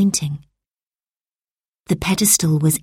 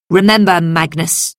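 stern warning; 4 dB louder than usual. A narrator's reading. Senator warning.

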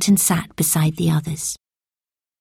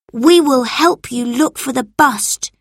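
put-on boy voice; 7 semitones higher, 3 dB louder than usual. A narrator's reading. Robert voiced.

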